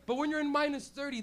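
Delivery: ordinary talk, nothing marked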